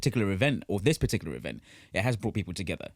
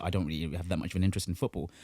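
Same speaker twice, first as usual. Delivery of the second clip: low, fast, bored non-fan; imitating a disinterested viewer; mock indifferent voice; 7 semitones lower, 5 dB quieter, faster than usual. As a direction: rattle it off like someone who couldn't care less. Mocking non-fans, low and fast.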